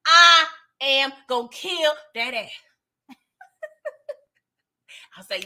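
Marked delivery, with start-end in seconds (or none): 0.1-2.5 slow menacing threat. Retold or imitated. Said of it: "she was like".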